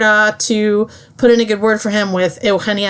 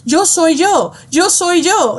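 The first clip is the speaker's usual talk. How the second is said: higher, pleading Spanish insistence; insistent repetition; 8 semitones higher, slower than usual. Retold or imitated. Augusto insisting to his author he exists, higher pleading. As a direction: repeat it as if clinging to yourself. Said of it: "He says".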